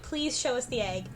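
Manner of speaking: normal talking voice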